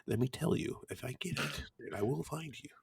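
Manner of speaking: hushed, menacing threat, dropping very soft; Liam Neeson. Acted. Podcaster mock-threatening co-host over spoilers, hushed movie menace. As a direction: threaten quietly, half-breathed, in a muddled movie-tough-guy impression. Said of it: "Liam Neeson from somewhere else".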